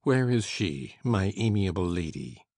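low, loud, slow sneering echo; mock the phrase with contempt; diabolical sneer. A narrator's reading. Heathcliff sneers at the guest's compliment, low and loud. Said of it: "diabolical sneer".

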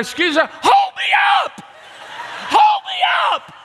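high yelling plea to strangers; desperate shout. Retold or imitated. Begging strangers to hold him up, high desperate yell. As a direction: scream for help to be lifted. Climbing rails to search crowd.

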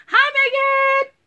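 high, loud squeal of hello; excited greeting. Real, not acted. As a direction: greet her like a long-lost friend.

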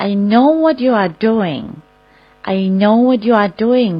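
very slow, deliberate English; slow model phrase. Shown as a demonstration. Teacher showing how to answer a scammer, very slow. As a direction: say each word slowly to the scammer. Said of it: "ตอบได้ว่า".

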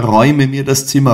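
the plea breaks into a frantic cry; rushed, loud "räume mir das Zimmer". A narrator's reading. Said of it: "rief jene".

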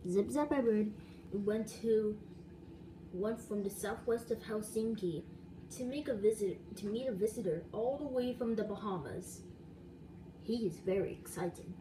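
plain reading voice

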